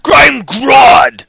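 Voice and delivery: shouting tyrant demanding worship; booming villain shout. Acted. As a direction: roar it high and loud, far off his own voice. Voicing Grodd villain.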